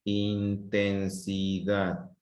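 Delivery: slow, measured "in-ten-si-dad"; syllable by syllable. Shown as a demonstration. Teacher telling pupils to listen to themselves, slow syllable-by-syllable word. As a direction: draw out each syllable of "Intensidad". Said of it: "escúchate a ti".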